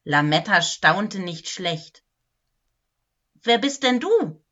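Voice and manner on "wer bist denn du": higher-pitched, surprised asking; surprised question. A narrator's reading. Lametta questions the Wichtel who suddenly appeared, surprised. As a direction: ask it in raised-pitch surprise. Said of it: "fragte sie überrascht".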